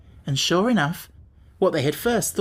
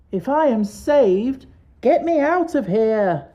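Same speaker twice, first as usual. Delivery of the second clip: much higher, louder, slow call from under the sofas; 8 semitones higher, 4 dB louder, slower than usual. A narrator's reading. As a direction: plead to be pulled out. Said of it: "called Jack".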